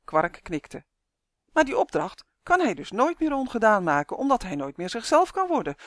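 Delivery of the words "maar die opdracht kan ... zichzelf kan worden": put-on higher, frustrated boy's protest; frustrated outcry. A narrator's reading. Justin protests to Kwark about the impossible task, frustrated. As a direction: protest in mounting exasperation. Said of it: "riep Justin gefrustreerd".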